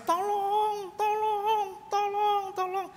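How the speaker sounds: very high, drawn-out wail; mock crying for help. Preacher imitating crying voices from hell, high drawn-out wail. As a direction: imitate distant wailing souls, long held high cries. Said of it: "orang nangis".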